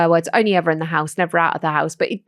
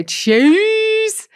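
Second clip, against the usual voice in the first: put-on high squeaky child voice; kids' cheese; 10 semitones higher than usual. Toddler copying mum, high piping cheese. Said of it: "them two go".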